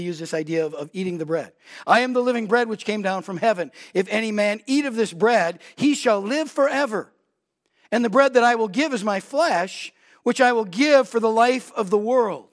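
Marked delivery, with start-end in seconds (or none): 1.8-12.5 louder, higher pulpit reading; impassioned preacher reading; fervent scripture reading. Acted. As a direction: proclaim it to the congregation. Reads Jesus' words fervently.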